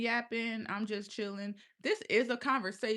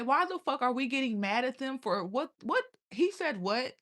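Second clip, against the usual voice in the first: normal talking voice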